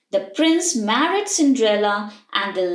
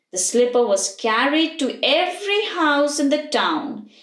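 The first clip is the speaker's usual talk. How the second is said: plain reading voice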